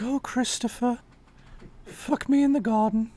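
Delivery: high put-on falsetto; hammed-up high swooning voice; mocking imagined caller. Co-host imagining what the rival would say, high mock voice. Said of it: "He's like".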